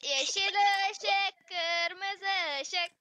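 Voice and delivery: slow, drawn-out game chant; oyun komutu. Pretend. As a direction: drag each word out as the caller. Calling the game's command again. (uzata uzata söylenen oyun tekerlemesi)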